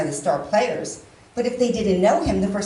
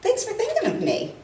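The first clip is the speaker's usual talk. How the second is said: sincere thanks; 12 semitones higher than usual. Shown as a demonstration. Woman shows how she meant her text, sincere. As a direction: thank them sweetly, lifting the pitch. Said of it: "You see the difference? I said".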